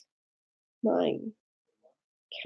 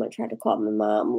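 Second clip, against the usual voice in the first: ordinary talk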